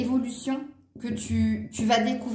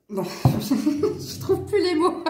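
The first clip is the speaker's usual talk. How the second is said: real, helpless laughter through the words; laughing; 6 semitones higher, much slower than usual. Real, not acted. Tarot reader can't find her words, real laughing fit. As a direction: crack up while talking, higher and drawn out.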